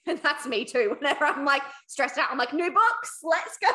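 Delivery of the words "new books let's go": higher and louder, eager; rally yourself with sudden glee; mock eager cheer. Telling co-hosts how she copes with stress, eager higher cheer. Acting her own stressed reaction.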